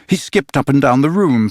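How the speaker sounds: plain reading voice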